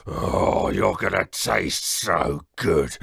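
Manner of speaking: slow, low menacing relish; gloating giant. Acted. Giant gloating over his meal, menacing. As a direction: savour the threat.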